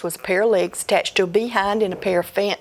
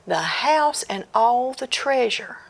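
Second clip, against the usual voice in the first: usual speaking voice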